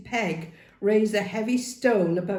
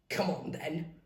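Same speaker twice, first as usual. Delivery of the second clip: growled; 6 dB quieter than usual. A narrator's reading. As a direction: growl it as a dare. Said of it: "growled Peg".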